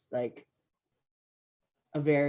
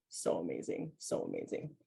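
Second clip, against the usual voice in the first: fading awe; 10 semitones lower, 8 dB quieter than usual. Real, not acted. Overwhelmed memory of Tahiti.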